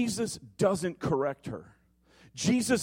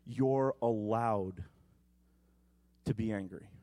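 tender reassurance; 7 semitones lower than usual. Acted. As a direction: lay each permission down gently. Holy Spirit's comforting words.

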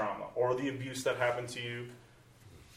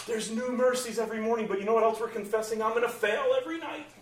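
bright singsong parody, much higher; mock cheerful; 10 semitones higher, 4 dB louder, faster than usual. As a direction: chirp it like a happy slogan. Mocking how believers confess it.

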